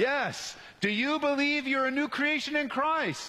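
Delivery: high-pitched, forceful preacher's challenge; great force. Real, not acted. Preacher calling the church to answer, with great force. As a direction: ring it out to draw a yes from the room. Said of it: "Say this with great force".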